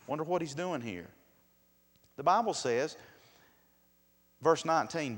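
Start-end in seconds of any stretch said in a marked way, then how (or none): none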